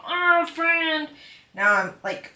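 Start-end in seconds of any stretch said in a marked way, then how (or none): 0.1-1.1 excited greeting. Retold or imitated. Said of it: "running at them and being like".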